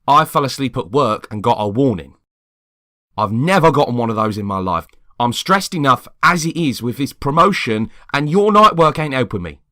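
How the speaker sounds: loud, rising frustrated rant; angry outburst. Acted. Tired neighbour loudly venting his built-up anger. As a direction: let the stress boil over.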